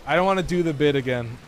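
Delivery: normal talking voice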